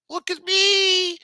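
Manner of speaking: very high, drawn-out loud squeal; exaggerated high-pitched cry for attention; mock attention-seeker. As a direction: whine it out in a mocking falsetto. Mocking attention seeker posting hospital selfies.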